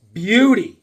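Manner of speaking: gleeful collector's cry; excited exclamation. Shown as a demonstration. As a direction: savor the word beauty like a prize. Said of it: "I got this beauty".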